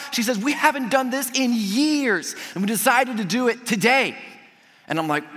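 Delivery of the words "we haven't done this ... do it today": high, stretched-out delight; retold excited announcement. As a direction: imitate a thrilled hostess sharing news. Retold gushing lady continues.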